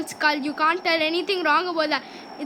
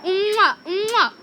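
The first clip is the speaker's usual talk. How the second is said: big smacking air kiss; loud, drawn-out "mwah"; exaggerated kisses; 4 dB louder than usual. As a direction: blow noisy kisses at a crush. Blowing kisses at beautiful player.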